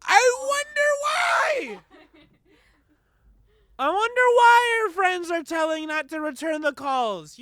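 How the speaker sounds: loud, high, disbelieving yell; incredulous shout. Real, not acted. Yelling back at the voicemail.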